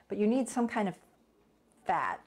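ordinary talk, nothing marked

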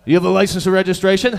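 fast, loud traffic cop. Retold or imitated. Cop asking for papers, loud and fast. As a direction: rattle it off like an impatient cop.

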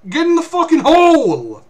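high, loud urgent shout; urgent yell. Real, not acted. Player screaming at the kid to get in the hole, urgent yell. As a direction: yell the order at the companion. Yelling at companion to hide.

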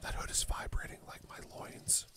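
breathy joking whisper. Real, not acted. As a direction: whisper the joke aside. Joking aside after outro.

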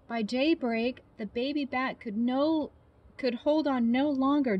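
put-on lively storybook narration for kids; reading to children. A narrator's reading. Biologist reading a bat story to children, animated. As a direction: read it like bedtime story time. Reading a picture book aloud.

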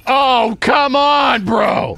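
real frustrated yell; frustrated shout. Real, not acted. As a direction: shout at the screen in disbelief. Yelling at game.

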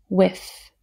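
ordinary talk, nothing marked

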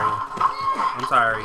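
slow, stretched I'm sorry; drawn-out sorry. Real, not acted. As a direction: drag the sorry out. Apologizing while game chaos.

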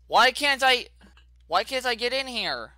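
high, loud frustrated question; annoyed gamer stuck at a door; exasperated. Real, not acted. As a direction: demand it at the game.

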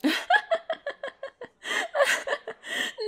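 high, long laughing fit; helpless laughter. Acted. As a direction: crack up at their story. Laughing at date's embarrassing story.

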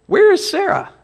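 high, eager "Where is Sarah?"; visitors asking. Acted. Preacher voicing visitors asking for Sarah, lightly high. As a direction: pitch up into a quick, curious question. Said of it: "their first order of business is".

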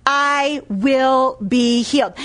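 slow, evenly spaced words; deliberate declaration. Retold or imitated. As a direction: declare it word by word, slowly. Preacher repeats the woman's declaration.

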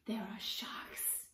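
slow hushed whisper; spooky whisper. Acted. Little sister telling her brother about sharks, hushed whisper. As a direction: whisper it like a secret danger.

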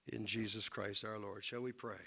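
ordinary talk, nothing marked

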